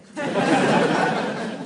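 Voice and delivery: breathy chuckle; amused chuckle at the dolphin candidate; real laugh. Real, not acted. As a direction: laugh at the memory of the sketch. Laughing at own sketch idea.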